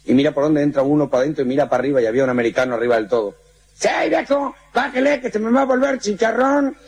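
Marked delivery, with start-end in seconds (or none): 3.8-6.7 high, pleading call upward; Mexican calling up. Acted. Fireman calling up to the American, high and pleading. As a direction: yell up to him to come down.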